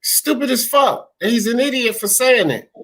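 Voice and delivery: normal talking voice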